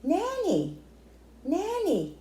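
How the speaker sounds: put-on high, small toddler voice insisting; insistent toddler. Toddler insisting her grandma is at the window, high and small. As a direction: say it like a baby pointing at the window. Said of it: "She kept insisting".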